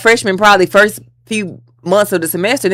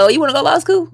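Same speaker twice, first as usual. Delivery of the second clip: high, bright mock professor voice, raised pitch; imitating the professor; 10 semitones higher, faster than usual. Professor asking a student in the hallway, lightly voiced high and eager. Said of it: "He like".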